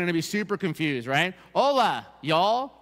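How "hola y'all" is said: put-on high, louder Ecuadorian-then-Tennessee accent greeting; mock Ecuadorian mother, then Tennessee mother-in-law accent; two different accents. Pastor mimics mother and mother-in-law, contrasting Ecuadorian and Tennessee accents. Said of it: "Two different accents".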